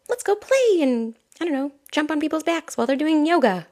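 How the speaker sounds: giddy little goat inviting mischief; playful child goat. Acted. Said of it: "That's like".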